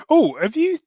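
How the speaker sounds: ordinary talk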